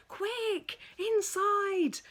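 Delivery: high, loud urgent shout. Acted. Missus Thomas shouting everyone in from the rain, urgent. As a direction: hurry everyone indoors. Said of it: "shouted Missus Thomas".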